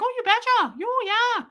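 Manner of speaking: put-on very high, over-friendly Midwestern accent; a Christmas elf with a Midwestern accent. Overly happy stranger answers doubting traveler, Midwestern accent. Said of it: "most friendly little Christmas elf".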